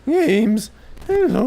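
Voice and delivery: very high, loud mock teen squeak; squeaky puberty voice. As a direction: squeal it like a pubescent boy. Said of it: "squeaky puberty voice".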